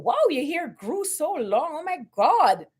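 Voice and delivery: high, put-on wowed voice; mock amazement, swooping pitch; mock gushing. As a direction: imitate someone gushing over a big surprise. Mocking the coworker's amazement again.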